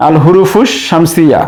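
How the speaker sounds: ordinary talk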